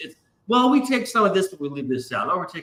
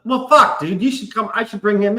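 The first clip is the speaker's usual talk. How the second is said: excited outburst; 7 semitones higher than usual. Real, not acted. Sudden realization, exclaims.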